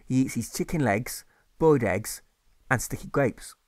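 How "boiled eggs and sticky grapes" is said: usual speaking voice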